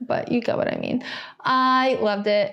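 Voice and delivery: ordinary talk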